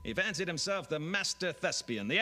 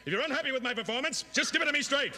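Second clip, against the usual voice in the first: high fast desperate plea; frantic pleading; 10 semitones higher, faster than usual. Acted. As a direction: beg the boss, higher and faster. Actor pleading in sketch.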